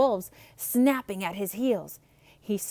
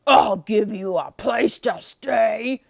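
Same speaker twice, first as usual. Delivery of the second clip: very loud, slow threatening shout; 12 dB louder, slower than usual. A narrator's reading. Beast shouting threat at Maurice, loud and slow. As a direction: bellow it slowly. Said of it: "shouted the beast".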